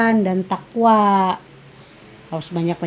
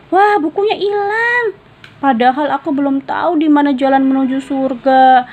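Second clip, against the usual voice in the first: much higher, louder alarm; worried child; 7 semitones higher, 5 dB louder than usual. Acted. Raihan finds the book gone, high and alarmed. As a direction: panic about the lost book.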